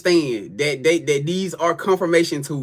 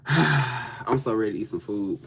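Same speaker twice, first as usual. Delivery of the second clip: longing sigh; 8 semitones lower, 8 dB quieter, slower than usual. Real, not acted. Longing for barbecue, sighing.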